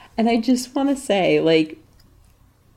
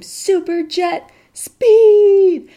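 high, loud, stretched-out shout; imitated kids' scream; 11 semitones higher, 9 dB louder, much slower than usual. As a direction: scream it like excited kids, dragging the last word. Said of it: "and they scream".

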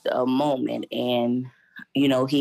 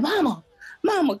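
calling mama; 12 semitones higher than usual. Retold or imitated. Son searching the living room, calling for his mother. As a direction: call out for mama like her son looking for her.

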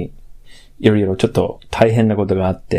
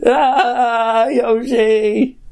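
mock sob; 12 semitones higher, much slower than usual. Mock crying over absent cohost.

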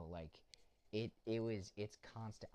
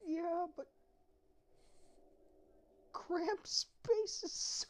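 squeaky high voice; 5 dB louder, slower than usual. Pretend. Mocking the cramped cellar.

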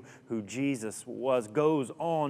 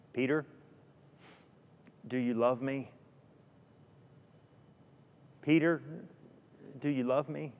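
gentle question; 5 dB quieter than usual. Acted. Jesus asking Peter gently.

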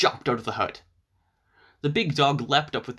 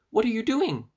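put-on little girl voice; 10 semitones higher than usual. A narrator's reading. Girl asks cat.

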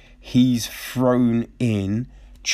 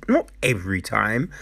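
ordinary talk, nothing marked

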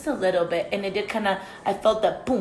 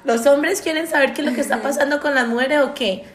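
scolding rant; 3 semitones higher, 6 dB louder than usual. Acted. Scolding male listeners.

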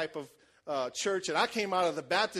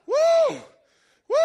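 very high, loud woo, even higher; joining the woo; 13 dB louder than usual. Retold or imitated. Preacher swept up, woo-ing very high beside her. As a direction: let a huge high woo fly. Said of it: "And I'm like".

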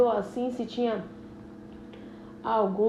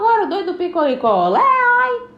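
louder street cry, high oi; singsong beach vendor call; 8 semitones higher, 8 dB louder than usual. Acted. Vendor cry imitated in complaint.

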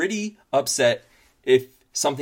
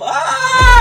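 high piercing shriek; panicked scream; 14 dB louder than usual. Acted. Panicked outcry.

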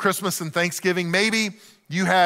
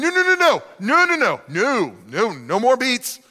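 frantic fake refusal. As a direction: dodge a second helping, hammed-up. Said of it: "And you're like".

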